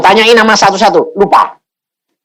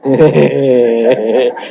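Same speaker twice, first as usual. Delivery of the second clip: short chuckle at his own joke; a quick amused laugh; 4 semitones lower than usual. Real, not acted. Preacher laughing at his own joke, amused chuckle.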